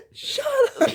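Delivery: high laughing shut up; giggling protest at the teasing. Real, not acted.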